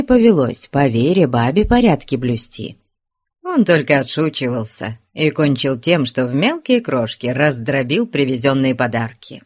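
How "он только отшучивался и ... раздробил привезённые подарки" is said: ordinary delivery, nothing marked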